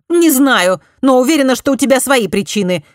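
parry instantly and hard; quick retort. A narrator's reading. Daughter firing back at her accused cousin, high and loud. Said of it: "немедленно парировала Элиза".

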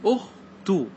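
أخ تو split as "ukh... tuu"; damma, syllable by syllable. Shown as a demonstration. Teacher checking students follow, أخ تو in separate syllables. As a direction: sound out أخ, brief pause, then تو, both with u. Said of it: "مثلًا ضم تقول".

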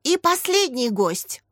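high, slow show announcement; stretched, ringing presenter's call; announced in chorus. A narrator's reading. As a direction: announce the last guest with fanfare. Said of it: "хором объявили ведущие".

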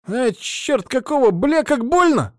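high, loud cry of pain; pained scream. Acted. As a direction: yell out in sudden pain. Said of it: "раздался крик проводника".

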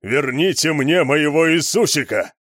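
loud, high bellow; enraged bellowing; furious roar. A narrator's reading. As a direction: roar the demand. Cook roars for his friend.

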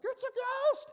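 very high, loud mock shriek; hammed-up squeal of terror; comical terrified yell. Preacher imitates disciples seeing a ghost, comical shriek. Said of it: "it almost sounds comical".

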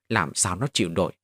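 ordinary delivery, nothing marked

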